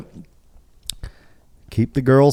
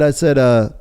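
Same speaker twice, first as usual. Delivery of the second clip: ordinary talk, nothing marked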